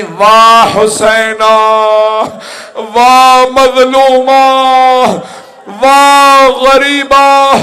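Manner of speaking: high, loud, long-held cries of grief; wailed lament. Acted. Rabab mourning with hand on chest, wailing lament. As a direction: cry out each name, slow and swelling to full voice. Acting out the bereaved mother's lament.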